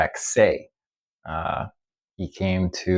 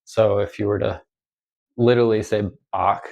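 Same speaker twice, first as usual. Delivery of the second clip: usual speaking voice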